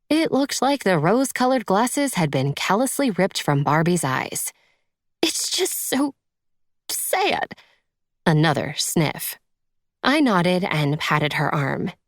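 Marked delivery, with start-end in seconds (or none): 5.2-7.5 very high, tearful little voice; acted tearful lament, pitched way up; weepy woman. A narrator's reading. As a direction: whimper it high, close to sobbing. Tearful stepmother, another sniff follows.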